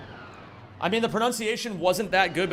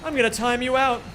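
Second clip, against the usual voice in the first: mock grandiose; faster than usual. Mock tyrant streamer.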